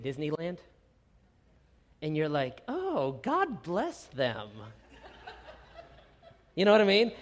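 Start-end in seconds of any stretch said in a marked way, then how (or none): 2.7-4.5 sarcastic blessing. Retold or imitated. Sarcastic envious Facebook comment.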